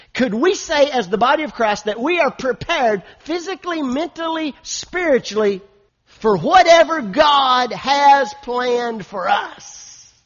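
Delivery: loud challenge rising to a drawn-out shout; building full-force preaching. Real, not acted. Pastor challenging the church to examine itself, preaching to a shouted climax.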